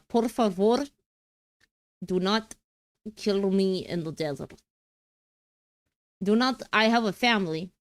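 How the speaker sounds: badly faked Mexican accent drifting into Slavic; Slavic-tinged Mexican accent. Streamer pleading as a drama character, mock Slavic-Mexican accent. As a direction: attempt Mexican, land halfway to Slavic. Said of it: "This is all starting to sound slightly Slavic".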